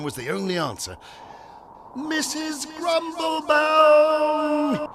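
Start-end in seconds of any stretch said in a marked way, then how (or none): 2.0-4.9 high, long-drawn call; top-of-lungs shout. A narrator's reading. Algernon calls the housekeeper in the sky, shouting. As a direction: holler the name as loud as possible, slowly. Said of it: "he shouted at the top of his lungs".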